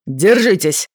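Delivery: sharp loud warning shout. A narrator's reading. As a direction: yell a warning to brace. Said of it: "крикнул Джейк".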